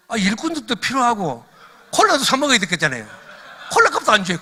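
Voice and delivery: loud, high comic griping; mock indignation. Preacher joking to congregation that God gave no extra, loud mock indignation. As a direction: hammer out the petty grievance for laughs.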